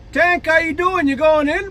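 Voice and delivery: bright check-in from the quarterback, voiced high; quarterback asking. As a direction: quarterback asking the dazed fullback if he's going back in. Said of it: "he comes over and he goes".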